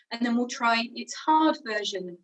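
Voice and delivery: normal talking voice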